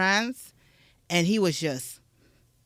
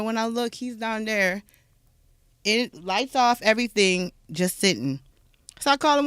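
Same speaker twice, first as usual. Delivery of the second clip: ordinary talk, nothing marked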